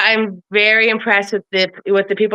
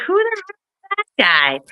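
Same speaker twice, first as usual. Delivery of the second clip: "Who the hell" flung out much higher, mock baffled; incredulous disbelief; 10 semitones higher than usual. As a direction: retell your own stunned reaction in a high squeak. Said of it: "I was like".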